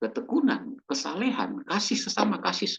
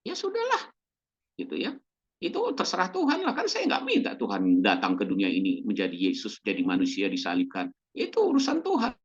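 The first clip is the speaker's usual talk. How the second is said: dismissive shrug; 7 semitones lower than usual. Retold or imitated.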